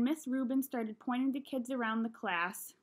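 ordinary delivery

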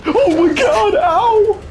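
high panicked yelp of pain; pained cry. Real, not acted. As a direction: cry out as you're hit. Hit by enemy.

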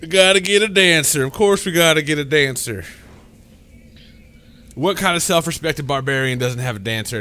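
loud. Pretend. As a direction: shout it like a proud barbarian. Hamming up barbarian demands.

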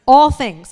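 "All things" punched loud and falling; stress on all things. Real, not acted. Preacher to church, stressing "All things" loudly. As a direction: hammer "All things" home to the congregation. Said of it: "I cannot stress that word enough".

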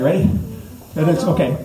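ordinary talk

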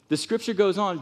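ordinary talk

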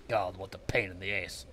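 low, soft grumble fading away; sulky grumbling. Real, not acted. Player cursing a game he hates, sulky grumbling. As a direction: grumble under your breath, trailing down.

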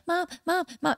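high-pitched imitation of nagging children; pestering kids. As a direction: call out like kids pestering their mother. Voicing kids constantly calling mom.

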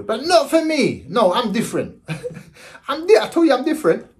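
high, laughing proud claim; laughing boast. Real, not acted. Joking to viewers about his odd artery, high and laughing. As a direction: laugh through it, enjoying being the odd one.

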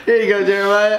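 laughing shout of glee. Real, not acted. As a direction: call out through laughter. Laughing while handing over bean.

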